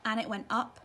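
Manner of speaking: ordinary delivery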